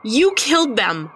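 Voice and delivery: high, loud furious cry; furious yell. Acted. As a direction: scream the accusation at him. Narrator screams at Jason.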